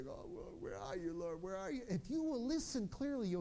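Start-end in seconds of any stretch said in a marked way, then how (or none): 0.1-1.8 softer, tentative calling out; searching plea, acted. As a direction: play a lost seeker, hushed. Acted seeker calling for God.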